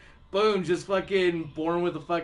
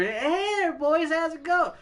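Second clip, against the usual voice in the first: put-on much higher, sing-song girly greeting; 9 semitones higher than usual. Women at the bar greeting the guys, high and sing-song. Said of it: "some chicks will come over".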